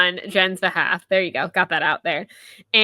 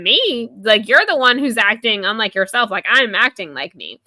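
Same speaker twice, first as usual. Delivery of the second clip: loud, raised, imitated indignation; indignant retort; 3 semitones higher, 6 dB louder than usual. Sydney answering Jen's accusation, indignant retort. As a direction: throw the accusation right back, mock-offended.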